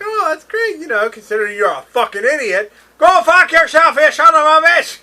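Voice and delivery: high, loud cursing rant; swearing tirade. Retold or imitated. Acting sketch swearing.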